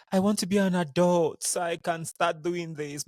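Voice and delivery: a child wishing to grow up, half-mocked; imitating niece. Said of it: "My niece will be like".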